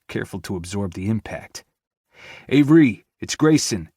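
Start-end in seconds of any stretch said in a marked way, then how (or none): 2.5-3.9 loud urgent call. Acted. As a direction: call out to her through the rubble. Calling into collapsed building.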